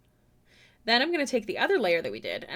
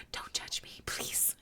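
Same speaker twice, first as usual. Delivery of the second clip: whisper; 12 dB quieter, slower than usual. Real, not acted. Embarrassed whispered plea.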